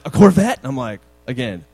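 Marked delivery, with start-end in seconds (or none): 0.0-0.6 put-on much louder, higher eager child's guess; excited kid guess. Daughter guessing the car, loud high squeal. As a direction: squeal the guess like a little girl. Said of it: "And Sienna's like".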